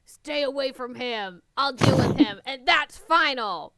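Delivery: loud, firm and serious. Acted. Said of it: "speaking in a serious tone".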